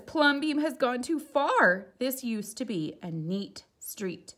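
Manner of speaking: high, loud, slow complaint; scandalised townsfolk scolding; indignant neighbours. Acted. Neighbours fed up with his house, high and loud.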